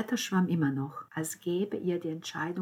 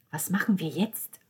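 a hint of conspiratorial plotting; 5 dB louder than usual. A narrator's reading. Father plots with family at the stairs, lightly conspiratorial. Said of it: "fragte Niccolò verschwörerisch".

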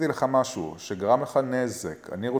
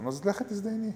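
high-pitched, lightly delivered send-off; mock dismissal; 11 semitones higher, 3 dB quieter than usual. Man answering his hinting girlfriend, mock high-pitched dismissal. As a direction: toss off the insult in a high voice.

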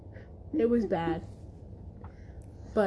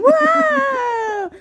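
high, loud excited "Wow"; big excited "Wow"; 9 dB louder than usual. Acted. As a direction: shout "Wow" in delight. Imitating the recorded "Wow.

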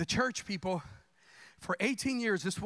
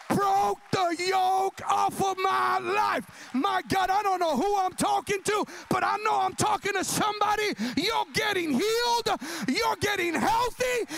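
much higher, loud shouted proclamation; preaching at full force; 12 semitones higher, 5 dB louder than usual. Real, not acted. Preacher proclaiming healing to the congregation, full shout. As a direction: shout the healing over the crowd.

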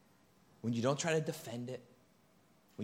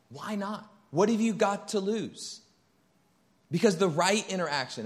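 higher and louder, driving the point home; preaching at full force; 7 semitones higher, 6 dB louder than usual. Acted. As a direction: preach it out to the congregation, full throttle.

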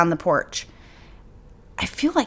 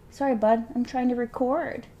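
soft pet talk; 5 semitones higher, 5 dB quieter than usual. Real, not acted. Talking to her dog.

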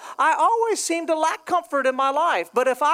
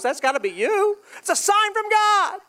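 rising to a high, excited mock proclamation; mock revelation; 8 semitones higher than usual. Preacher jokingly pleading with God over dollar floats, mock revelation. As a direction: build up as if hailing a divine sign.

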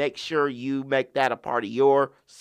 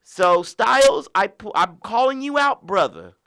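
loud call-out; 5 semitones higher, 6 dB louder than usual. Acted. Calling out a wrestler.

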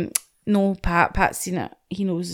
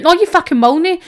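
high, loud scolding no; indignant retort; 8 semitones higher, 5 dB louder than usual. Real, not acted. Ranting friend snapping back at a cyclist, outraged. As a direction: shout it down, outraged.

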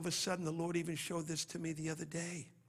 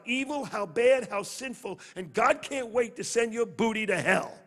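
preaching at full force; 6 semitones higher, 8 dB louder than usual. Real, not acted. Preacher raises voice.

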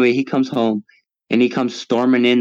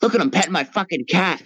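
friend's mocking voice pitched much higher; mocking homeowner; 8 semitones higher than usual. Friend laughing at the intruder petting the cat, mocking high voice. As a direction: imitate the friend jeering at the burglar on camera. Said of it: "his friend's like".

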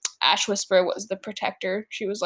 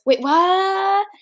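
high, baffled "wait, what"; confused; 9 semitones higher, 3 dB louder than usual. Acted. Horatio baffled by the princesses' answer, high confused surprise. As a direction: jump up in pitch, lost. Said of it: "Horatio was very confused at this point".

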